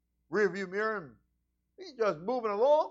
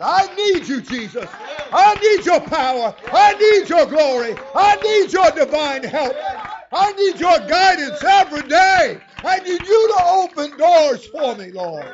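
loud, high, pleading prayer; fervent prayer; 9 semitones higher, 11 dB louder than usual. Real, not acted. Preacher praying aloud to Jesus before the church, loud and fervent. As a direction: cry out to Jesus with full force. Prayer cried out mid-sermon.

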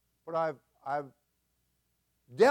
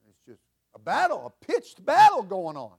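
higher and louder, rising preacher cadence; preaching at full force; 8 semitones higher, 14 dB louder than usual. Real, not acted. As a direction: lift the congregation with fervor.

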